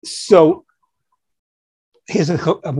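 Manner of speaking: ordinary delivery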